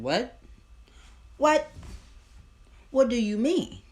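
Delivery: high, loud, incredulous "what?"; stunned shout of disbelief; shocked disbelief. Retold or imitated. Shocked reaction to dream revelation.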